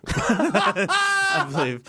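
high, drawn-out laugh through the words. Real, not acted. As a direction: giggle it out, slowly. Laughing after movie joke.

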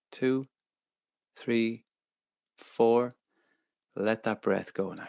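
calm counting through each breath; soothing, unhurried breathing guide; guided meditation. Acted.

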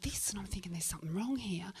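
whispered, uneasy aside; hushed suspicion. Acted. Storyteller confiding that something is wrong with Shane, hushed suspicion. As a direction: drop to a cautious whisper. Confiding a worried thought to audience.

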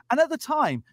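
ordinary talk, nothing marked

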